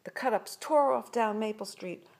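ordinary delivery, nothing marked

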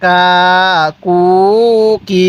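"কা, কু, কি" each held long and level; measured syllable chant of "কা, কু, কি"; drawn-out vowels. Shown as a demonstration. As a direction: stretch "কা, কু, কি" with pauses between. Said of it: "এক আলিফ টানের নিয়ম".

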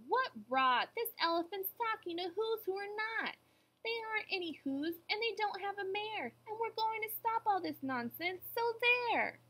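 high-pitched scolding monkeys; mock shouting. A narrator's reading. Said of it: "The Wickersham brothers came shouting".

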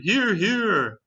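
high, swooping cry of agreement; group cheer. A narrator's reading. Creatures all backing the ladybug, high cheer. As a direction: call out agreement high, falling away. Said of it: "they all shouted".

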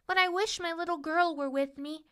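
small, high, wistful voice; homesick princess. A narrator's reading. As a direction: wish aloud as a lonely paper doll. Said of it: "felt homesick".

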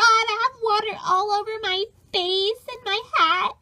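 normal talking voice

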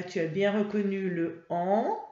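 usual speaking voice

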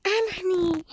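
ordinary talk

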